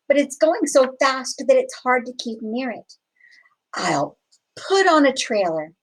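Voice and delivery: usual speaking voice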